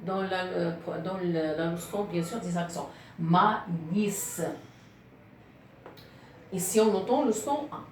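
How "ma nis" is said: MA, NIS in two separated beats; syllable by syllable. Shown as a demonstration. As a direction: split Ma, nis with a pause between the syllables. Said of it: "il y a trois syllabes".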